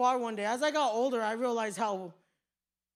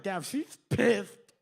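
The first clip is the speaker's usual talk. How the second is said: mock headgear speech; 5 dB louder, much slower than usual. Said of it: "had this whole headgear".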